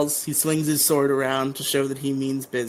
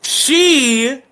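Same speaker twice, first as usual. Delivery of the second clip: "she" high, loud and held; stress on "she"; 11 semitones higher, 8 dB louder than usual. Retold or imitated. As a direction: land hard on "she" as the reveal. Acted reveal of who it is.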